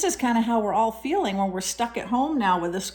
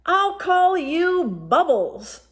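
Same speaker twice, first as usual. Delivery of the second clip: smiling; 8 semitones higher, 4 dB louder, much slower than usual. A narrator's reading. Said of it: "Polar Bear smiled".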